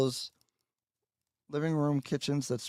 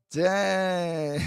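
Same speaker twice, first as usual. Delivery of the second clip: dismayed; 4 semitones higher, 9 dB louder than usual. Real, not acted. Dismayed at stream failure.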